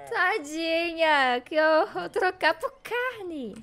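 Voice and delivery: high, drawn-out dismay; horrified pity. Real, not acted. Streamer pities the cow to be traded for meat, horrified.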